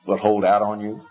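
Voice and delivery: usual speaking voice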